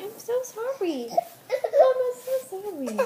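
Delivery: high, laughing through a teary sorry; laughing apology. Real, not acted. Mother laugh-crying an apology to her baby.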